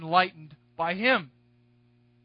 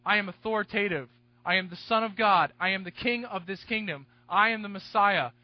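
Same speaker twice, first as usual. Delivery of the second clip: ordinary delivery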